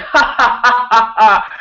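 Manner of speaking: loud, high burst of ha-ha laughter; hearty laugh. Retold or imitated. Dad laughing on a walk with his kids, loud hearty laugh. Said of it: "I just started laughing".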